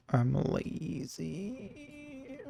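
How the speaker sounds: last word stretched out, climbing very high; weird voice glide. Real, not acted. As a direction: let the voice slide up into a long high squeak. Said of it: "That was a weird thing my voice did".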